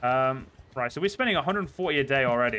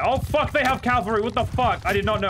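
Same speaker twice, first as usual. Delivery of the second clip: higher, louder panicked blurt; alarmed surprise; 8 semitones higher, 3 dB louder than usual. Real, not acted. Streamer spotting enemy cavalry, shocked and alarmed. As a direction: caught off guard, raising the alarm.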